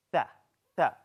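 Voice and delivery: tha with the tongue tip out between the teeth, not sa; correct tha. Shown as a demonstration. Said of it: "This is wrong".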